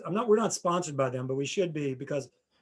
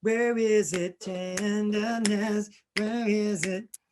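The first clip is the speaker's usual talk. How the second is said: drawn out searching; 4 semitones higher, much slower than usual. Real, not acted. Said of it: "Come on, Sketch".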